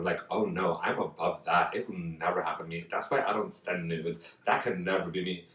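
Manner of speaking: ordinary delivery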